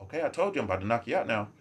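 soft, low, rapid warning; polite threat. Acted. Reminding someone who said huh, quick and softly polite. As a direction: repeat the threat courteously, voice kept down. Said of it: "I'll be so polite".

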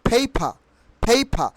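"পেপার" as phei-pha, silent r; corrected pronunciation. Shown as a demonstration. Teacher answering learners, "পেপার" as phei-pha. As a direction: puff both p's in "পেপার", drop the r. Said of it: "পেপারকে আমরা কিভাবে উচ্চারণ করব".

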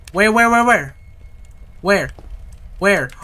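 loud, rapid-fire repeated question; frantic alarm. Real, not acted. Frantic search after scare.

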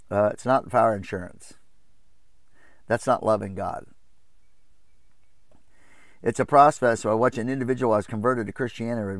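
usual speaking voice

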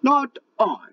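very high squeaky refusal; dog refusing. Acted. Lazy dog refusing the hen, very high voice. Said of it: "barked the dog".

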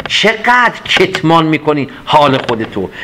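ordinary talk

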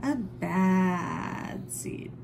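usual speaking voice

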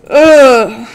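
big groan of disgust; loud annoyed ugh. Real, not acted. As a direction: groan it out, fed up. Disgust at needing Hydra blood.

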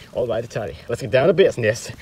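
normal talking voice

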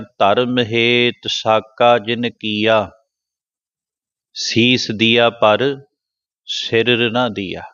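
scripture recitation. Shown as a demonstration.

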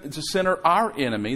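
ordinary talk

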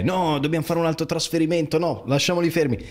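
a playful impression of the wife saying no; wife's objection. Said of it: "poi è".

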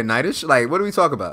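normal talking voice

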